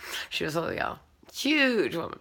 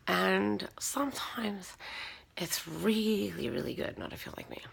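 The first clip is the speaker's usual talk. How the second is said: whisper; 2 semitones higher, 11 dB quieter than usual. Real, not acted. Confiding aside.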